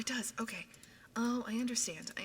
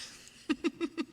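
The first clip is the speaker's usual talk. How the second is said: high, loud fit of giggles; giggling; 11 semitones higher, 6 dB louder than usual. Real, not acted. Streamer tickled by a gifting oil baron, giggling. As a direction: burst into helpless real giggles. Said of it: "I am very giggly".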